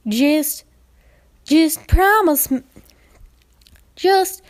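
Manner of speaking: high, loud, slow broken plea; high, loud faltering plea; pleading filly. Acted. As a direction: beg her sister with the words catching. Applebloom pleading.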